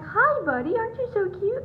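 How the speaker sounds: put-on loud, high-pitched doggy baby talk; imitated gushing. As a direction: gush like a stranger fussing over a puppy. Staff fussing over the dog.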